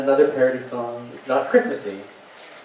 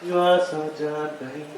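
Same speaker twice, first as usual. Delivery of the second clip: soft echo; 4 semitones higher, 8 dB quieter, slower than usual. Acted. Comedian echoes his own chorus, softly. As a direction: sing it back quietly.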